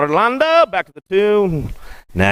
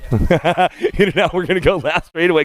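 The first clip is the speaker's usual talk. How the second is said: chuckling through the call, slowed; laughing. Real, not acted. Announcer laughs while calling the race to Tia.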